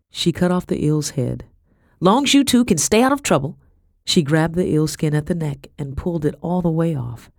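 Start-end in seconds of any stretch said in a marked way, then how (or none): 2.0-3.6 folksy Becky, cautioning. A narrator's reading. Becky's dialogue in narrated story.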